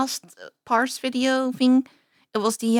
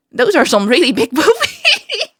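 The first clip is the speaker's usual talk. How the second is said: higher, louder, breaking into real laughter; cracking up; 4 semitones higher, 5 dB louder than usual. Real, not acted. Viewer giggling at the booby's size, cracking up mid-sentence. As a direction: start the remark, then burst out laughing.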